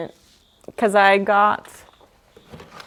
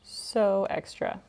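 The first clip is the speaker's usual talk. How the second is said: quiet repeat; 3 semitones lower, 11 dB quieter, slower than usual. Real, not acted. Repeats the same words quietly.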